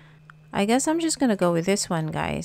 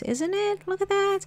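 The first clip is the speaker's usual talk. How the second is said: gushing coo; 12 semitones higher than usual. Real, not acted. Cooing over a kitty painting.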